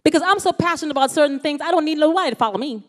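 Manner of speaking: ordinary talk, nothing marked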